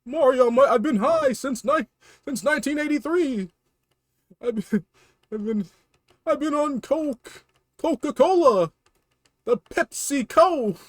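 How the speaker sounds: high, pleading little-brother voice with laughs; whiny Luigi. Acted. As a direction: squeal for Mario's help.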